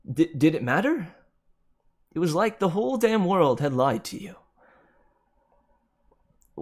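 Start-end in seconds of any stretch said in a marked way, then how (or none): 0.1-4.4 higher and louder, bitter and wounded; a raised, stung voice of betrayal; anguished outburst. A narrator's reading. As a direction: cry out that the world lied.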